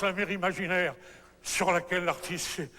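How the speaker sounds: usual speaking voice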